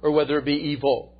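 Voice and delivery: high, loud scripture declamation; full-force preaching. Acted. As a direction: hammer out verse after verse at full voice. Preaches rapid chain of verses.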